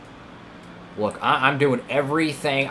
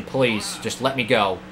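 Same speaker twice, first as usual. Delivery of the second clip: ordinary delivery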